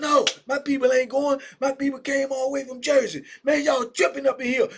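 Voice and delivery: high, loud protest; high shouting complaint about the visits; angry inmate shout. Retold or imitated. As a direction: yell it at the guards, fed up. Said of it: "dudes in there talking".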